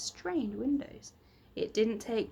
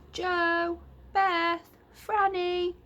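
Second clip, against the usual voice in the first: higher, louder call from afar; 9 semitones higher, 6 dB louder, much slower than usual. Acted. Mother calls the children from the cottage, higher and louder. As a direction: call the children home across the garden. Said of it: "came their mother's voice from the cottage".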